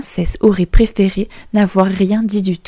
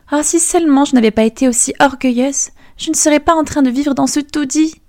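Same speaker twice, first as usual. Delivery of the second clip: much higher, lamenting princess; mildly hammed-up remorse; regretful thought; 7 semitones higher than usual. Princess alone in the hovel, higher regretful lament. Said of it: "la princesse pensa".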